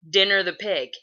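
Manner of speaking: lightly voiced dad, a bit loud; dad echoing. Dad repeating the girl's question to his son, lightly imitated. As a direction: repeat it at normal pitch as the dad.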